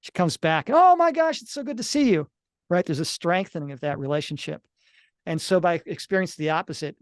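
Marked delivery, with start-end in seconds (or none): 0.7-2.3 high, loud gush of delight; excited greeting. Retold or imitated. Wife welcoming husband home after three months, excited gush. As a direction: burst out with joy at seeing him.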